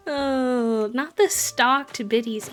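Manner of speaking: loud, drawn-out excited cry; excited exclaim. Real, not acted. Streamer yelling excitedly at her generous chat. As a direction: shout it to chat in delight.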